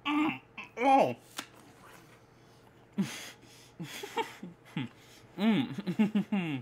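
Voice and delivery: chewing a big bite; laughing with mouth full; mouth full of apple. Real, not acted. Seen 0: Bit apple just before; hand at closed mouth, chewing through laughs.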